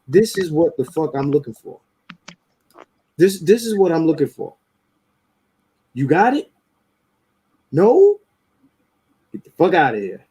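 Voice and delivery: blunt, no-nonsense demand, No shot up high; firm demand. Retold or imitated. Man acting out a blunt demand to a woman, firm. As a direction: lay the law down, no asking. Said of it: "Demand is".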